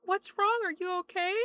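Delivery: lightly acted sympathetic stranger; concerned customer. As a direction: ask it in a high, mock-concerned voice. Voicing worried customers in story.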